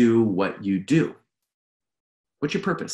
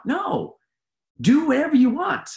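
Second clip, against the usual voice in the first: emphatic objection. Real, not acted. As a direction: voice leaps high in protest. Cuts off imagined self-doubt emphatically.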